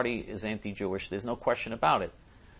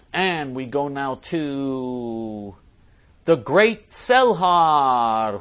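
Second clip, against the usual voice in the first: slow, stretched introduction, louder on the name; drawn-out announcer intro; 3 semitones higher, 8 dB louder, much slower than usual. Real, not acted. Host introduces next commenter in a drawn-out announcer voice. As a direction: announce the next guest like a showman.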